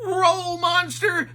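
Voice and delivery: high, loud dog shout; Scooby shouting. A narrator's reading. Scooby shouting at the rolling monster, high and loud. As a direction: yell it like a panicked cartoon dog. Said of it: "Scooby shouted".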